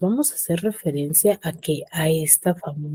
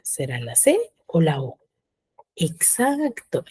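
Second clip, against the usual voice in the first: ordinary talk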